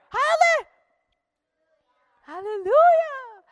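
joyful hallelujah. Acted. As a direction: shout hallelujah in joy. Preacher celebrates salvation in story.

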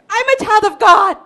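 high, loud and brave; courageous declaration. Acted. Girl stands up to her father, courageous declaration. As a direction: declare it boldly. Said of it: "God gave her courage".

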